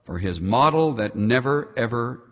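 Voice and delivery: hushed, reverent prayer voice. Acted. Pastor leading the congregation in prayer, hushed and reverent. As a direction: pray slowly and sincerely.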